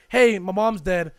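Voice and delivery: blunt announcement. Retold or imitated. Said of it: "I think I literally said it like that".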